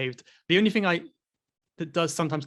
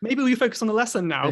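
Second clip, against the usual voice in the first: put-on higher, louder, tactfully steering a child back; awkward teacher redirection; 7 semitones higher, 5 dB louder than usual. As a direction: rein in a distracted pupil politely.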